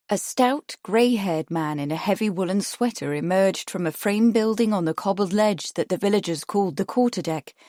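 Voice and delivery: ordinary delivery, nothing marked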